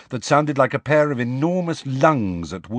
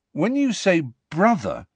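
slowly; 8 semitones higher than usual. A narrator's reading. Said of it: "said Harry slowly".

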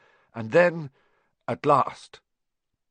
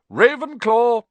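loud, higher shout in another voice; the Sorting Hat bellows the house; hat's shout; 12 semitones higher, 9 dB louder than usual. A narrator's reading. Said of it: "hat declares house".

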